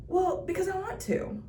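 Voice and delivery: imitated young man. Imitating the young man's reply.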